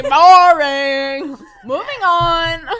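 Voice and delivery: loud, dismissive "Boring"; a loud, laughing brush-off; mock bored shout. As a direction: bark it out like a bored kid. Mimicking the bored child.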